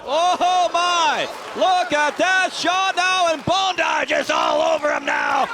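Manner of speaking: high shouting knockout call; excited commentary. Real, not acted. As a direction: erupt at the knockout.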